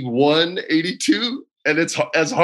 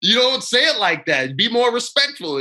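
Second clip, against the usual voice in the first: high-pitched indignant telling-off; mock scolding; 8 semitones higher than usual. Pitcher trash-talking the confident batter, mock scolding. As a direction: scold in playful, mock offence.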